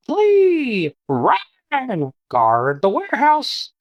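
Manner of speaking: very high squawking cry, slowing at the end; high panicked screech of a critter; panicked creature shriek. Acted. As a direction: shriek like a frightened little creature. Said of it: "you hear something inside go".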